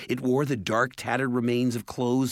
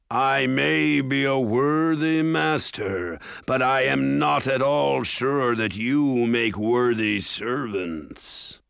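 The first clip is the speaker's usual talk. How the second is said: slow, louder, sneering ogre voice; menacing ogre; 3 semitones higher, 4 dB louder, slower than usual. A narrator's reading. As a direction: sneer down at your servant. Ogre mocks the dwarf.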